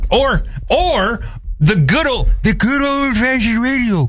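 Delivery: put-on high, wavering old-timer fiddling with the radio; old man voice. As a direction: play a creaky old man. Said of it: "from your old man impression".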